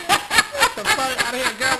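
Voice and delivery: slow, loud laughing dismissal; laughing disbelief. Real, not acted. Friend hearing about the list, laughing in disbelief. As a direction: laugh it off loudly.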